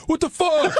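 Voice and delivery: high-pitched outburst, retold; mock an irate colleague's high-pitched cursing; cursing up a storm. Producer quoting a colleague cursing over the speaker, high mock outrage. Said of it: "DePace is in here cursing up a storm".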